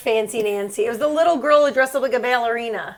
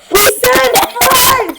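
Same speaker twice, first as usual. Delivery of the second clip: amused outburst; 10 semitones higher, 7 dB louder, much slower than usual. Real, not acted. Mock outrage at wrong accent.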